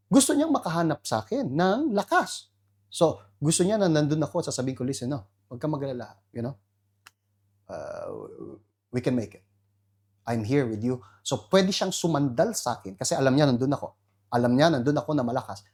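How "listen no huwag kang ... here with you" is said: soft, fast reassurance to a wife; reassuring. Retold or imitated. The husband telling his sad wife he's there, softly. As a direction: comfort her quietly.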